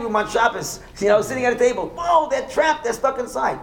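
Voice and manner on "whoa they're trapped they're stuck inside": high, wide-eyed whoa; an outsider's hammed-up amazement; mock amazed outsider. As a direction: gasp at the trapped diners. Outsider gawking at Shabbos table.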